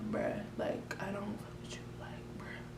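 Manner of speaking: cold whispered brush-off; hushed dismissive whisper. Retold or imitated. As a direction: whisper it, cutting her off. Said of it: "I was like".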